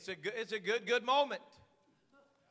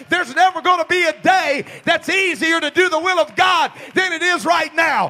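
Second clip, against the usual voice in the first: loud, rising sermon shout; full-force preaching; 7 semitones higher, 15 dB louder than usual. Acted. Preacher urging his church, loud and building. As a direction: drive it home to the congregation.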